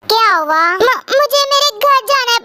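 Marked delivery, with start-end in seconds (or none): none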